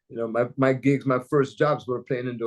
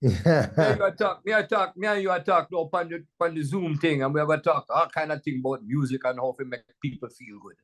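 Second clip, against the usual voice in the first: lilting, higher-pitched Jamaican patois; 4 semitones higher than usual. Shown as a demonstration. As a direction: chat like a Jamaican DJ to a fellow DJ. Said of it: "you're a Jamaican D J".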